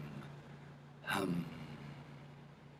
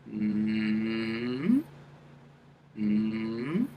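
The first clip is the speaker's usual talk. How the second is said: long hum rising into the forehead; rising forehead hum demo; forehead mm-hmm; 3 dB quieter than usual. Shown as a demonstration. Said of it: "Do it again".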